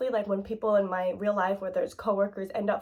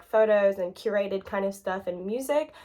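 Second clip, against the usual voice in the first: ordinary delivery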